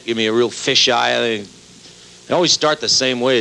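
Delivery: ordinary talk, nothing marked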